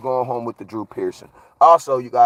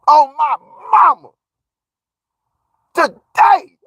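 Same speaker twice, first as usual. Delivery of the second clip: excited shout; 13 semitones higher, 6 dB louder, slower than usual. Real, not acted. Excited celebration of ten million views.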